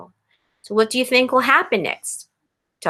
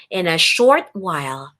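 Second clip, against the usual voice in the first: plain reading voice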